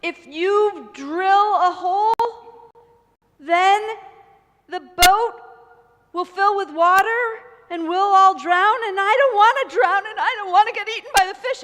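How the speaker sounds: high, loud and frightened pleading; panicked prince. Acted. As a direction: beg in rising panic, terrified of drowning. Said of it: "he got worried. He's like".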